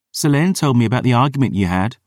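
put-on annoyed girl voice. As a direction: huff it out, fed up. Dior's girl voice.

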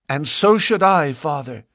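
put-on high, spirited young woman. A narrator's reading. Lucy answering her father with spirit. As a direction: answer back with pluck. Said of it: "she answered with spirit".

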